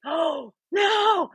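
high, drawn-out cry of dismay; horrified high "oh no". Retold or imitated. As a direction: wail it as the damage happens. Acted reaction to car scraping.

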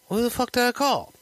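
high-pitched incredulous question; retold alarmed disbelief. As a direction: blurt it out high, mock-horrified. Retold alarmed reply to confession.

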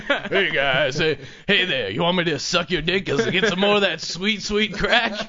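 mock crackhead. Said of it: "just like".

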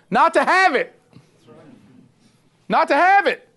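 emphatic answer. Real, not acted. Preacher drives home the answer.

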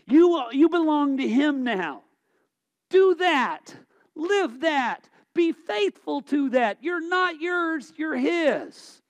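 loud, high exhortation; preaching at full force. Acted. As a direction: drive it home to the congregation.

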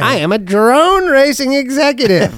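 high, slow, grandiose announcement; pompous executive. Acted. Man boasting he is a drone racing executive, mock-pompous. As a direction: declare a silly job title with mock importance.

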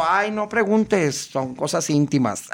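slow, drawn-out camp coyness; La Garra. Retold or imitated. Prankster in character dodges the question, slow coy camp voice. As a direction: deflect coyly, stretching the words. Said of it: "Y yo".

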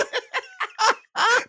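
high loud burst of laughter. Real, not acted. Mid-story, a high loud laugh. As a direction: shriek with laughter.

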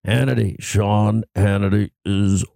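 low, slow morning radio voice; deep groggy announcer drop; Scott Shannon impression. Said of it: "He does, as soon as he wakes up".

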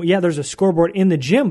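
ordinary talk

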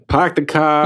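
put-on slow, drawn-out Boston accent; attempting a Boston accent. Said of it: "I can't do Boston accent".

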